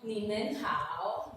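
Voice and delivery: ni men hao, slow, with a rise on hao; ni men hao said slowly, each tone clear; Chinese greeting. Shown as a demonstration. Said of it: "what is ni men hao".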